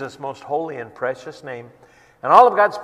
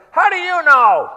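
much higher and louder, shouted heckle; heckler; 11 semitones higher, 9 dB louder than usual. Retold or imitated. Evangelist heckling his friend to draw a crowd, shouting. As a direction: heckle the preacher hard. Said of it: "I'm gonna start heckling you".

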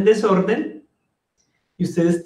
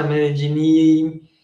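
normal talking voice

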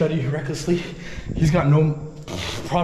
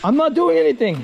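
high, strained raised voice; indignant protest; 11 semitones higher, 4 dB louder than usual. Real, not acted. Filmer defending himself to an attacker, indignant shout. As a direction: protest your innocence loudly.

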